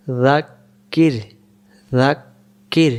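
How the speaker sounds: very slow joined word; slow model reading. Shown as a demonstration. Teacher blending syllables for beginners. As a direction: blend it slowly, stretching it. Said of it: "joins spelled syllables into word".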